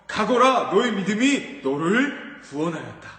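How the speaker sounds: loud, firm blessing; solemn pronouncement. Acted. Jesus declaring to the healed blind man, firm and loud. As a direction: declare it with authority. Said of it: "한마디 해주셨어요".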